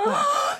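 high sharp gasp; shocked gasp. Real, not acted. Shocked by needle advice.

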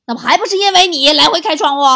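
exasperated retort. Acted. Older sister answers little cousin about the window, loud exasperated retort. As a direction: fire back loudly, fed up.

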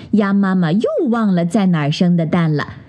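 ordinary delivery, nothing marked

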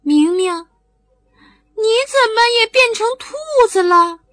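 sly squeaky bunny; teasing little rabbit. A narrator's reading. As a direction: rabbit marvelling at the boy, drawn out. Rabbit voice in story.

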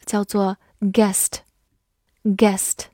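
usual speaking voice

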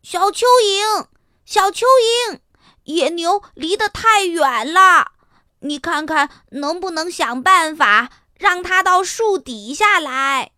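high, loud call down from the tree; calling loudly. A narrator's reading. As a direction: shout across to the worm. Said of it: "他大声地说".

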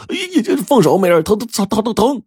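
high pleading yelps of pain, ear pulled; yelping in pain. Acted. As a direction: cry out as your ear is twisted. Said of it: "他忙讨饶说". (被揪耳朵高声讨饶喊疼)

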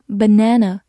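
"banana" said the American way; American pronunciation. Shown as a demonstration. Teacher shows learners the alternative, American pronunciation of "banana". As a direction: give "banana" its American vowel in the stressed middle. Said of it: "或者美式发音".

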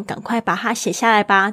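ordinary talk, nothing marked

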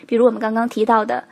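ordinary talk, nothing marked

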